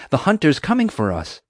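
high-pitched alarm; urgent warning. A narrator's reading. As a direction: warn a friend of danger. Warning that the hunter approaches.

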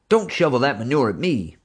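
angry rebuke. A narrator's reading. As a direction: throw it back at him. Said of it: "J J told him".

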